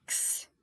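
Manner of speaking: "X" sounded softly as a bare "ks"; isolated X sound. Shown as a demonstration. Teacher letting students hear two consonants, isolated ks. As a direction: make "X" just a k and a hissed s. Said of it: "Você escuta um K e um S".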